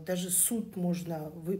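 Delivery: normal talking voice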